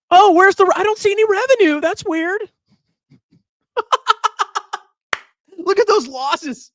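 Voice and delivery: high, fake-innocent searching; mock surprise. Host sarcastically hunting for missing revenue, mock surprise. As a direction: pretend to be baffled, tongue in cheek.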